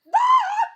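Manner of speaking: short, loud, high shriek; startled scream. Acted. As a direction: scream as if jumped. Startled by something beside them.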